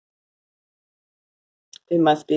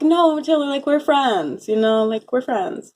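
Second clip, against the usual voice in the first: higher, earnest and clingy; pleading insistence; 7 semitones higher than usual. Retold or imitated. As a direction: beg her to agree, pitched up. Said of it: "but I'm like".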